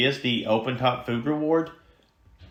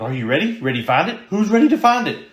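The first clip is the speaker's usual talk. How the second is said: hyping up the dog; 6 semitones higher, 3 dB louder, faster than usual. Shown as a demonstration. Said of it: "I'm gonna start talking to my dog".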